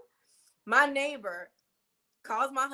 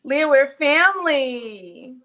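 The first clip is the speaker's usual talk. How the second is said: much louder, slow, stretched out; drawn-out loud call; 3 semitones higher, 12 dB louder, much slower than usual. Real, not acted. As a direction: stretch it like calling across a room. Repeating it with big emphasis.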